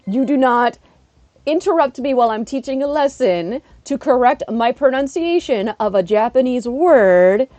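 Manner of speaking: hammed-up outrage, punching the words; mock threat. Teacher imagining telling off an interrupting coworker, mock threat. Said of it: "And I was just like".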